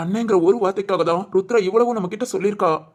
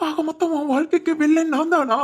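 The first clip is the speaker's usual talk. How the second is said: anguished crying; 7 semitones higher than usual. Acted. Friend blames himself for ruining the girl's life, wailing. Learns he ruined her life.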